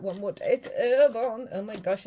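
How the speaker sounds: ordinary talk, nothing marked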